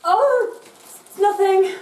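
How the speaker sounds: caught-out fluster. Acted. Teen caught out by mum.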